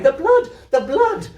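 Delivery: high, loud thrilled cry with a laugh; child leaping about crying out at the blood; excited cry. Acted. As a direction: shriek with gleeful horror.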